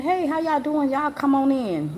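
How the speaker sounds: high, sugary welcome in a mock lady voice; a church secretary welcoming the family in a high, sweet voice. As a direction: put on the friendly secretary's high greeting. Secretary voiced greeting visitors.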